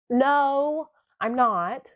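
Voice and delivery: high, drawn-out protest; emphatic inner no. Retold or imitated. Crying girlfriend's unspoken answer to the officer, emphatic high protest.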